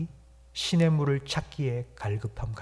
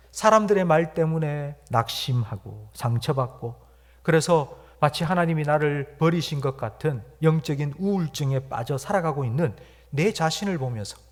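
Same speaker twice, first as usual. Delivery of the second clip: ordinary talk, nothing marked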